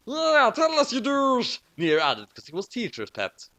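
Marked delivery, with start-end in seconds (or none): 0.1-1.6 put-on jeering kid. A narrator's reading. Teacher's pet jeers.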